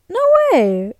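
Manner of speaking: loud, high "no way"; sarcastic-sounding disbelief. Real, not acted. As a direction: blurt disbelief that could pass for sarcasm. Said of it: "You're thinking I'm being sarcastic".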